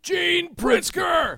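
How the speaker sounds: loud, high, drawn-out name call; excited announcement. Real, not acted. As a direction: announce the poet with a big shout. Host announces the next poet.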